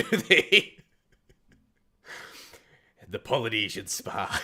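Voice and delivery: normal talking voice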